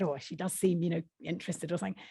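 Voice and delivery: soft, quick Yorkshire reply; mumble-light, hurried mild Yorkshire; Yorkshire accent. Acted. Young Barbara answering Marion, quiet quick Yorkshire accent. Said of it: "Barbara's the Yorkshire woman".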